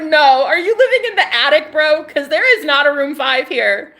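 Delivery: loud, high-pitched mock outrage; mock indignant arguing. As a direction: argue back in amused disbelief. Mock argument over room five.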